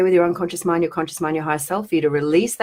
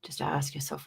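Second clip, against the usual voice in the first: normal talking voice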